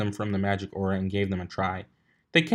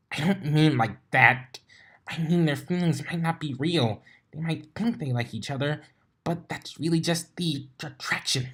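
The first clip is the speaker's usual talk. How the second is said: Twilight's voice; 6 semitones higher than usual. A narrator's reading.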